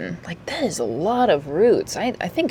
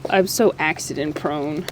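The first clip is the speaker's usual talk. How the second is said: ordinary talk, nothing marked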